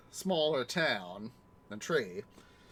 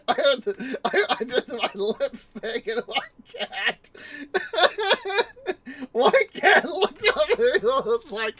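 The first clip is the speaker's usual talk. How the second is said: louder, higher, laughing through words; higher laughing narration; laughing while telling; 10 semitones higher, 8 dB louder than usual. Real, not acted. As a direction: talk through real laughter.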